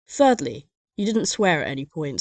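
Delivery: ordinary talk, nothing marked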